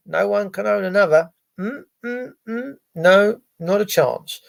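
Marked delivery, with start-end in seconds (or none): none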